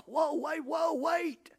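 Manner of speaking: high-pitched reenacted shock; startled alarm. Coach recalling his shock at a child cussing, startled and high. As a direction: jump up in pitch as if halting someone.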